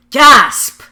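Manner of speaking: mock gasp said as a word, loud and high; spoken gasp. Lelouch seeing bullying, loud mock gasp. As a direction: say gasp out loud like a shocked kid. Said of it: "and he goes".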